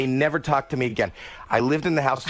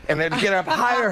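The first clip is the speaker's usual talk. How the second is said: usual speaking voice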